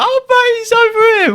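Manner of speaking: high, gleeful exclamation; squealed, amused alert to a friend; excited calling out. Retold or imitated. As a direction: re-enact spotting your mate, pitched right up. Acting out spotting his mate on CCTV.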